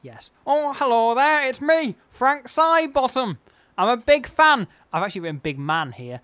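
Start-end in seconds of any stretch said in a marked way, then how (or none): 0.4-4.7 very high, loud, drawn-out comic character. Acted. Host introducing himself as the comic character, high loud impression. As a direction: holler a cheery greeting in a high silly voice. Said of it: "[name]'s favourite kind of brick tiling".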